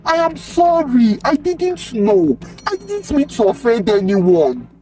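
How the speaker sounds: high-pitched, pleading apology; tearful, high-voiced begging; crying apology. A narrator's reading. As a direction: plead for forgiveness in distress.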